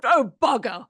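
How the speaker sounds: a light mock British oh bugger. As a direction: play the unflustered Brit, lightly. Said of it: "And I thought".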